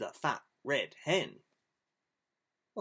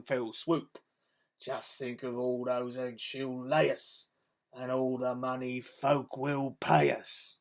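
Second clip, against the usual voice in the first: louder, gruff-voiced crook scheming; villain voice for the children's story; Hefty Hugh; 6 dB louder than usual. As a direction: play the big bully plotting the theft. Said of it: "But Hefty Hugh said".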